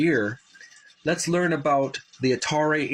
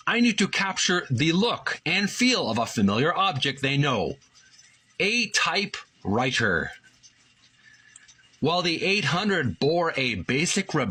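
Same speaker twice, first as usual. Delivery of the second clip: higher, measured read-aloud of a book page; article reading voice; 4 semitones higher than usual. Acted. Reading the Atari book to his viewers, a higher, careful read-aloud. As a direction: read the article slowly for the listeners.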